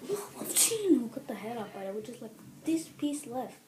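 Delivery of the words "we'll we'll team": mock imitation. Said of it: "Can you just go like".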